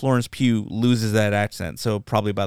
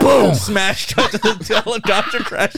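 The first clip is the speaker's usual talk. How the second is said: laughing retelling; 8 semitones higher than usual. Real, not acted. Fan re-enacts the helicopter crash, laughing hard. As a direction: shout the crash, then crack up.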